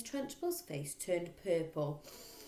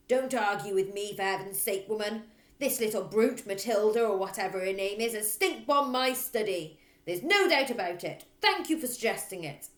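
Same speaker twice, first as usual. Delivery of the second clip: loud barking rant; loud bossy headmistress; 4 semitones higher, 8 dB louder than usual. Acted. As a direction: hammer every line like a bully. Trunchbull voice.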